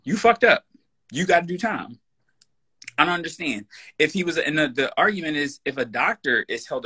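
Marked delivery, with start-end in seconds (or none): 0.0-2.0 higher, louder accusation; calling out. Acted. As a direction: call him out to his face, loud and high. Calling out Officer Chauvin.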